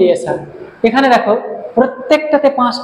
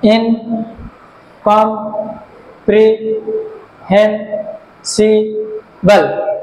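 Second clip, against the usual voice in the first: "ইনকম্প্রিহেনসিবল" very slowly, gap after each syllable; much slower than usual. Shown as a demonstration. Teacher recapping six syllables, "ইনকম্প্রিহেনসিবল" slowly split. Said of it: "syllable আছে".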